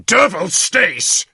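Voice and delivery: high, slow angry snap; sudden anger. Acted. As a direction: bite the words off in fury. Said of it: "a sudden and terrifying anger".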